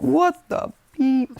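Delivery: high, loud, drawn-out disbelief; shocked outburst. Acted. Everyone seeing him turn into a giant, shocked. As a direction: stretch it out in stunned mock horror. Acting the class's shock at transformation.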